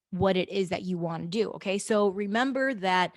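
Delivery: usual speaking voice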